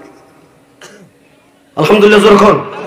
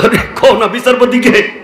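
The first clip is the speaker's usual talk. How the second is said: raised-voice sermon question; insistent question; 3 semitones higher than usual. Real, not acted. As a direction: press them for the answer. Presses congregation for louder answer.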